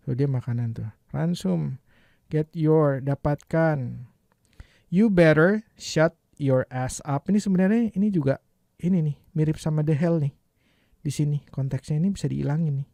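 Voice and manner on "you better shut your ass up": each word drawn out for learners; slow demo reading. Shown as a demonstration. Teacher reads the English line slowly for students. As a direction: read it slowly and clearly. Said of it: "teacher reads line slowly".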